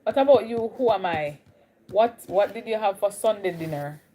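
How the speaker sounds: usual speaking voice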